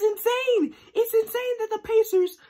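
high, loud and worked up; excited rant. Real, not acted. Fan ranting to viewers about the Pacers, worked-up outburst. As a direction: blurt it out in disbelief.

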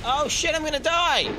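loud, high panic yell; panicked shout. Real, not acted. Gamer panicking mid-fight at dying, loud and high. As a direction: shriek that death is coming.

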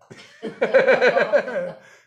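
big high hearty laugh; loud laughter. Real, not acted. Guru laughing at a devotee's sneeze, loud and high. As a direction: burst out laughing.

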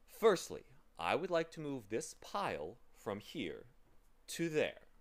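pleasant man, happy. Acted. Pleasant man assigning three tasks to travelers, happily emphatic. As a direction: announce tasks with cheerful, deliberate emphasis.

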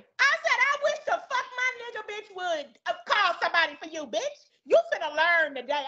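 high, scornful refusal; retold defiant refusal. Cashier refusing the customer's request, high and scornful. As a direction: refuse her outright and mock her.

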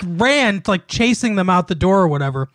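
usual speaking voice

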